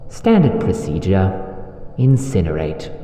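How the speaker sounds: low, nonchalant command; casual, low-pitched dismissal; dismissive order. Acted. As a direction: hand down the order offhandedly. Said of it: "The order is dismissive, almost nonchalant".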